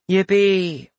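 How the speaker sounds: flat sarcastic yippee; bored. Acted. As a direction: say it utterly unimpressed. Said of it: "the female mage's bored voice".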